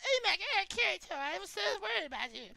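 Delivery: put-on very high, breathy anime girl. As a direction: squeal it like a worried anime heroine. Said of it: "how creepily accurate your anime girl voice is".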